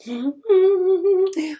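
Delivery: high, loud taunting cackle; mocking laugh. Melanie laughing at her cousin, high mock laugh. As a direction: laugh at him to his face. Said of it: "she kept staring at me like".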